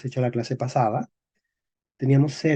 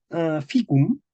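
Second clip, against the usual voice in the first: ordinary delivery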